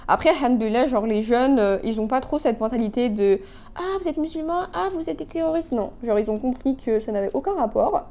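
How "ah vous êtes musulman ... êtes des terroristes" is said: high, soft mock of a prejudiced person; imitating a bigot, high and hushed; mocking ignorant voice. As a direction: parody the narrow-minded reaction, pitched up.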